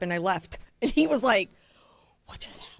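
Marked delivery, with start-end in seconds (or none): 2.3-2.7 imitating a dumbfounded man, voiceless; barely audible, shell-shocked question; stunned whisper. Customer left holding the ring, stunned whisper.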